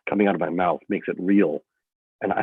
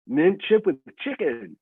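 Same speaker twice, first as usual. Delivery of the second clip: mocking tease; 9 semitones higher, slower than usual. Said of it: "He's making fun of it".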